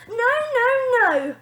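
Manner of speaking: high, loud panicked protest. A narrator's reading. Girl protesting at the doorstep, high and loud. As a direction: wail no at the nanny. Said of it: "protested Bonnie".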